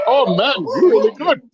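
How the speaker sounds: high-pitched mock Indian accent; imitated Indian accent. Storyteller as impressed shopkeepers, imitated Indian accent. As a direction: admire him in a put-on Indian accent. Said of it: "people spoke like this".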